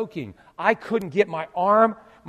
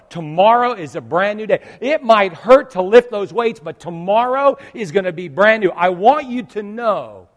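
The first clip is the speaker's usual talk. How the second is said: loud, rising preacher's encouragement; full-force preaching; 4 semitones higher, 9 dB louder than usual. Acted. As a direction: preach it out to lift the crowd up. Encouraging opening of sermon.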